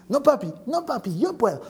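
high-pitched toddler refusal, acted; stubborn child. Believer refusing God's help like a child, mock childish protest. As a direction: protest like a little kid insisting on independence. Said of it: "Dios está mirando y dice".

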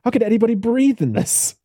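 high, squeezed complaint about the corset; a young lady choking in a tight dress; breathless Elizabeth. Retold or imitated. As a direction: gasp it out like the dress is crushing you. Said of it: "and it's like".

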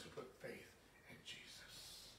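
soft whispered ending. Real, not acted. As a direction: sink to a whisper on the name. Sentence ends in hush.

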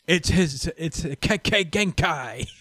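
slow solemn anime reveal of Kekkei Genkai; mock dramatic anime line. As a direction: announce it like an anime character. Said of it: "they were like".

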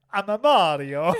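high, loud cartoon Italian plumber voice; Mario. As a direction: announce yourself in bouncy mock Italian. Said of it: "you sound like an Italian accent".

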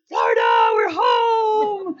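shouting with joy, high and loud; excited shout. Retold or imitated. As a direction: yell it like a kid arriving home. Son sees the Florida sign.

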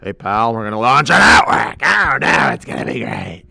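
loud, hammy boss voice; a pushy salesman voice; Vince impression.

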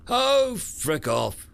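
exasperated brush-off; annoyed. Acted. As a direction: shoo him off, irritated. Annoyed swat at friend.

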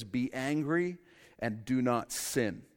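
slow, weighty verse reading; solemn scripture reading. Acted. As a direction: read the verse slowly and deliberately. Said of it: "reads the verse slowly".

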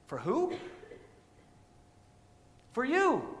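loud, high, driving sermon voice; preaching at full force. Acted. Pastor to congregation about Christ's blood, fervent preaching. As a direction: hammer each line home to the church.

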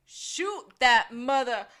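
loud, slow drawn-out mock shout; loud slow hammed-up yell. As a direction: bellow it slowly and loud. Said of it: "And Gus goes".

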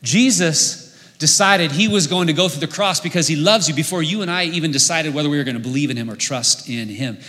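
ordinary talk

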